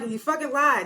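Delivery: put-on angry voice, pushed up high; mock furious accusation. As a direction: throw the accusation at her. Acted accusation quote.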